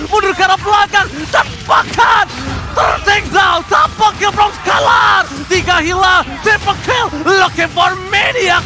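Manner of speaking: high, loud esports caster hype; fighting game announcer. Acted. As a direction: yell the teamfight like a hype caster. Caster yells through a teamfight.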